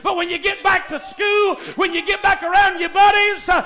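loud, driving revival sermon; full-force preaching. Real, not acted. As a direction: build urgently through repeated phrases, stretching out the last words.

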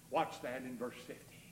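ordinary talk

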